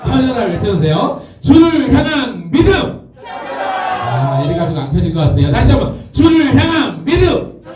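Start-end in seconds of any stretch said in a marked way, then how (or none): 1.4-3.0 high, drawn-out shouted cue; stretched call for a response; rallying call. Real, not acted. Cueing congregation to shout back. 6.1-7.6 high, stretched cue to the crowd; rallying call. Real, not acted. Repeated cue for shouted response.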